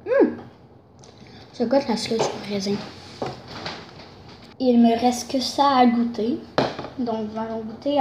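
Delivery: talking with mouth partly full; biting gummy between words; chewing candy. Real, not acted. Seen 2: bites purple gummy at lips, then talks while chewing it.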